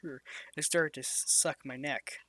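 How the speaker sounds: plain reading voice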